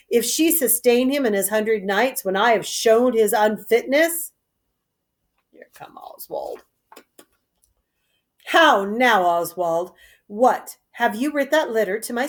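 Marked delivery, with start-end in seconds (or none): 5.5-6.6 quiet stage aside; whispered aside. Real, not acted. Narrator noting Oswald's entrance, whispered. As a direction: whisper under the scene.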